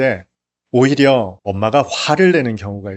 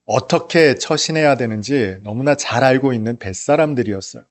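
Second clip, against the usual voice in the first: ordinary talk, nothing marked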